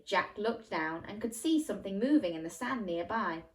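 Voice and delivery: ordinary delivery, nothing marked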